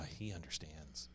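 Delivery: low, soft, slow ad voiceover; breathy sincere commercial read. Retold or imitated. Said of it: "like the Jesus commercials today".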